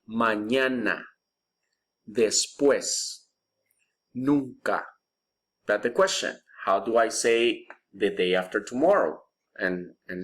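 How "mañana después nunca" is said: Mañana, Después, Nunca said slowly and clearly; model for repeating. Shown as a demonstration. Teacher drilling students: slow clear model words. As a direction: model each word slowly for the class. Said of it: "Repeat after me".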